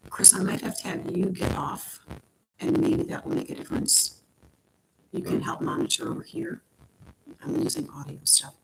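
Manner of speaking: quiet aside. Real, not acted. Aside to family off mic.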